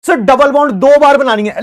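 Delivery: high, loud call to sir; mock student request. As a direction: imitate a student calling out to the teacher. Teacher voices a student's request. (छात्र की नकल में ऊँची आवाज़ में पुकार)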